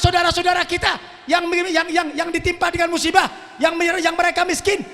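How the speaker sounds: fiery preacher's rebuke; preaching at full force. Acted. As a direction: hammer the congregation with reproach. Scolds the congregation.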